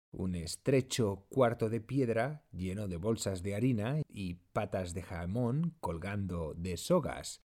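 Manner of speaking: plain reading voice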